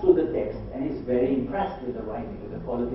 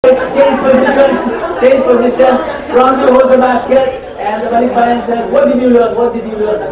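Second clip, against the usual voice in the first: loud, high drill-leader call; calling out instructions; 8 semitones higher, 16 dB louder than usual. Real, not acted. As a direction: call commands across a busy room.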